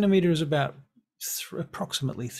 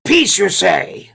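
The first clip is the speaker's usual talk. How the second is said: very loud, slow, higher goblin voice; scornful goblin; 6 semitones higher, 14 dB louder, slower than usual. Acted. Goblin answers the elf's offer of peace, loud scorn. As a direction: throw it back at them as a loud goblin.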